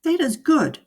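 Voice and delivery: high, pleased fish voice; relieved fish. A narrator's reading. Fish relieved the cat has gone, high. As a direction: sigh it out in relief. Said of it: "said the fish".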